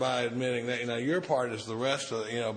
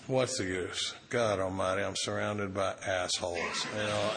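mock self-pity; 5 semitones lower than usual. Acting out the self-pity stage for the group, lower and sulky. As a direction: sulk it out like a martyr. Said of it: "the last stage is self-pity".

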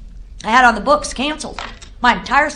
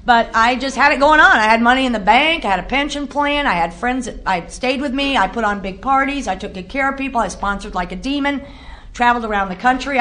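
usual speaking voice

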